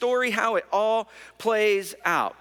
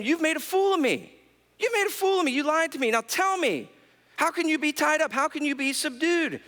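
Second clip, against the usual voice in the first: higher, mock-angry accusation; indignant; 8 semitones higher than usual. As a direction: scold him like a hurt, fooled lover. Delilah angry at being fooled.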